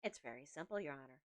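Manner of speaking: a hint of a snooty upstart; lightly done snooty voice. Shown as a demonstration. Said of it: "an upstart snooty voice".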